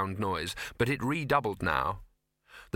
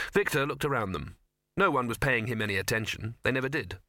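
usual speaking voice

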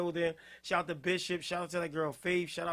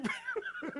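high breathy laugh; 13 semitones higher, 8 dB quieter than usual. Real, not acted. Host laughing mid-sentence.

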